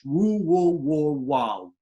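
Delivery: "Woo, whoa, wow" overdone, lips rounded like a fish; exaggerated lip rounding. Shown as a demonstration. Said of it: "we overdo it".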